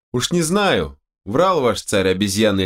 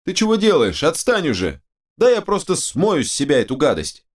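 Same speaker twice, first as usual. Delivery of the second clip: high, excited protesting; agitated squabble; 8 semitones higher than usual. Acted. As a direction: argue in a high voice, all worked up. Squabble over the precious slime. (возбуждённая перебранка высоким голосом)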